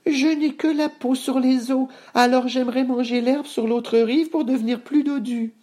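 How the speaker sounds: very high-pitched pleading goat; small, timid goat voice, way up high; feeble little goat. Acted. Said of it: "d'une voix faible".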